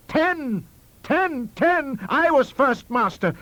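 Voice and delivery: high, loud, insistent correction; furious shouting. A narrator's reading. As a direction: hammer each word out in outrage. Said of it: "I shouted".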